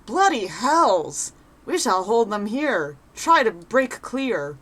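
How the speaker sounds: slow, drawn-out soldier's rallying cry; battle cry. Acted. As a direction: hold the line against raiders. Soldiers facing Ixal attack.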